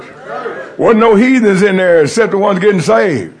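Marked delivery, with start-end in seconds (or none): 0.0-0.8 whispered echo, no voice. Shown as a demonstration. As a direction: breathe the word out softly. Echoed church, breathed out.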